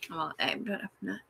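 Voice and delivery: usual speaking voice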